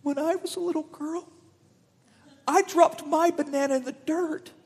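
high, teary mother; mom's story voice. Retold or imitated. Mom telling her son about her dropped banana, high and teary. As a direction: voice the mom tearfully recalling her childhood. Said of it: "she says to me".

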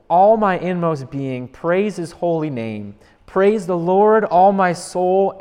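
slow, loud, solemn recitation; fervent scripture recital; psalm reading. Acted. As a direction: proclaim the psalm slowly like worship. Reads the whole psalm.

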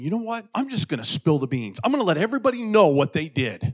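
retold flare of anger, pitch jumping up; mildly indignant, as if threatening to tell all; worked-up indignation. Preacher retelling his urge to expose others, mildly angry. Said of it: "getting anger inside and saying".

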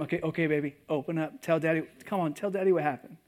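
put-on soft, gentle pleading to a child; quiet tender father urging his daughter; coaxing dad. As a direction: coax her to open up.

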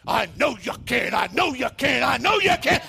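rising to a high, loud shout; building shout. Retold or imitated. Preacher voicing the Holy Ghost urging the believer on, building shout. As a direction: push them over the hill, shouting. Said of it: "the Holy Ghost is behind you".

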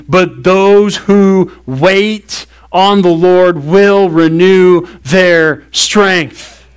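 very slow, every word weighed; slow emphatic quote. Shown as a demonstration. Pastor stressing the promise of Isaiah, very slow. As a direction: drag out "those who wait on the Lord" word by word. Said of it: "This scripture points it out immediately".

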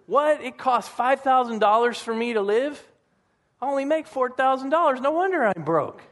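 a hint of a shocked broke person, voiced high; shocked discovery. Broke person who never tracked expenses, mildly mock shocked. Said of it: "they have no clue".

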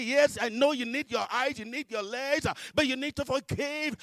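loud, high, emphatic voice of God; God insisting. Retold or imitated. God answering the believer's protest, insisting. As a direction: drive the answer home forcefully.

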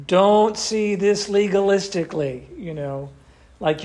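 usual speaking voice

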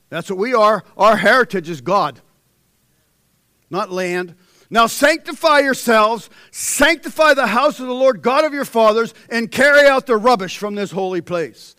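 loud, high, ringing sermon delivery; full-force preaching. Acted. Preacher reading Hezekiah's story to congregation, preaching loud. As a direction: preach it to the back row.

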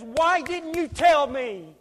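high, drawn-out reproach; anguished appeal. Acted. Lost classmate to believer at judgment, anguished appeal.

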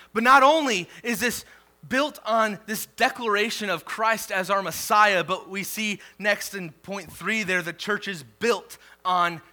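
preaching emphasis. Acted. Pastor opening his third point, loud preaching. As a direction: kick off the point with force.

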